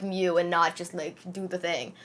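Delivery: ordinary delivery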